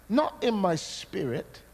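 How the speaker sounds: usual speaking voice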